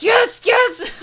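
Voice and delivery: high, loud burst of glee; secretly thrilled. Acted. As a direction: shout the excitement hidden inside. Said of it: "While inside, I'm like".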